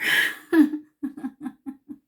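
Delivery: loud embarrassed burst of laughter; self-conscious laugh. Real, not acted. Laughing at herself for describing the wedding badly.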